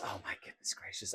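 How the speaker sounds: very soft, voiceless aside; whispered dismay. Real, not acted. Player after his Raider also fails, whispered dismay. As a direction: breathe it to yourself in disbelief.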